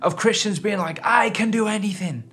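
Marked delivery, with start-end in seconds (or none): 1.0-2.2 mock boast, pitched up and sure; mock-confident boast; cocky self-belief. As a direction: sound like nothing can stop you. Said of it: "This sort of, like, unlimited potential".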